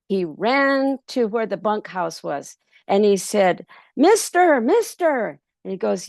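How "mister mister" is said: high, loud, breathless call for help; urgent calling out. Acted. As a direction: shout for the boss in a panic. Pedro runs calling the boss.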